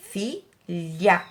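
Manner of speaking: "filha" split into two beats, fi, lha; syllable by syllable. Shown as a demonstration. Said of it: "separar em sílabas". ("filha" separando as sílabas)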